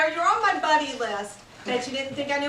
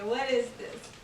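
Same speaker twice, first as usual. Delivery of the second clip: ordinary delivery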